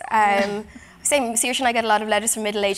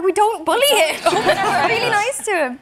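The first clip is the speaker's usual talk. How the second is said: laughing protest; 9 semitones higher, 3 dB louder, slower than usual. Retold or imitated. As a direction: protest through laughter, pitched higher. Retelling a defence against fans.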